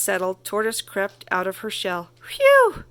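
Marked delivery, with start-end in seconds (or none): 2.2-2.8 very high, loud relief; relieved "Phew!". Acted. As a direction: breathe out after a close call. Tortoise safe after the danger.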